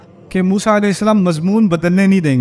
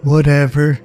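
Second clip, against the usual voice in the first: put-on teen whatever; 4 semitones lower, much slower than usual. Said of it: "سب سے خطرناک لفظ".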